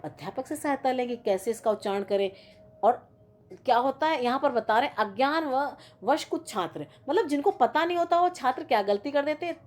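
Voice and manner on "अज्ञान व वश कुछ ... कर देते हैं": ordinary delivery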